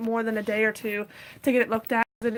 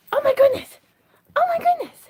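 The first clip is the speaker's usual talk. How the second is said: high startled cry of oh my goodness; real alarm, voice jumping up high; sudden high-pitched fright; alarmed gasp; 5 dB louder, faster than usual. Real, not acted.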